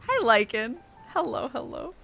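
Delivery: ordinary talk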